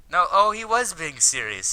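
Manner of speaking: loud, high-pitched disbelief; appalled realisation. Real, not acted. Reader realises the character meant it, loud appalled surprise.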